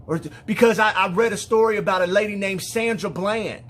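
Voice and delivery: urgent, high and loud retelling; retold tearful fear. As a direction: voice a distressed daughter explaining her fear. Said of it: "she's crying and tears are rolling down her face".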